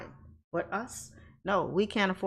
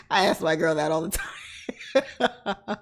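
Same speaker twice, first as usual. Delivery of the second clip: slow, laughing, and loud; 5 dB louder, much slower than usual. Real, not acted. Admitting it to the chat, laughing slowly. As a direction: speak through a giggle.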